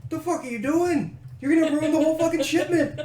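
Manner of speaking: frantic dockmaster shout; panicked Norlo yelling. Acted. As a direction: panic at the dragon over the shipment. Panicking Norlo yells at dragon.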